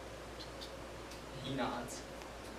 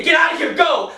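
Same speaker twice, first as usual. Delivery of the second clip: much higher and louder, desperate yell; shouted order; 11 semitones higher, 14 dB louder than usual. Retold or imitated. Soldier yelling at prisoner to flee, desperate shout. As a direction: drive him away with a full shout. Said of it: "I say".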